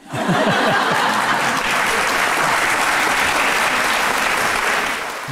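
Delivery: airy, drawn-out laughter; teacher laughing at the students; long breathy laugh. Real, not acted. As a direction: laugh it out freely.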